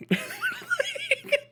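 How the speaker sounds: high, helpless cackle; laughing. Real, not acted. Streamer cracking up at his own bit, high laughter.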